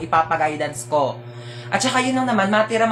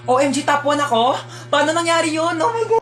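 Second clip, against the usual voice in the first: high, rising gasps of amazement; hammed-up shock at winning; overjoyed disbelief; 8 semitones higher than usual. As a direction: climb higher with each exclamation. Student learns she topped class.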